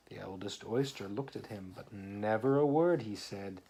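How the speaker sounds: lively read-aloud poem voice; story reading. Acted. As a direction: read the rhyme to a small child. Reads poem aloud to child.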